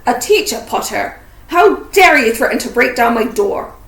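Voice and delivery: loud, indignant roar. A narrator's reading. Hagrid roaring at Harry for threatening his door. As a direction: bellow a furious reprimand. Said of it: "he roared at Harry".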